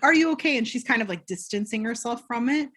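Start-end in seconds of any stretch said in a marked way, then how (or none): 0.0-0.6 higher and louder caring question; concerned villager. Retold or imitated. Community member checking on Danny, higher and louder. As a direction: call out with sudden concern.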